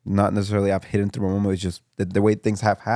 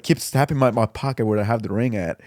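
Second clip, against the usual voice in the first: ordinary delivery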